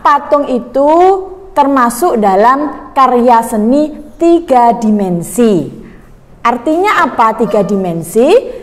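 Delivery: normal talking voice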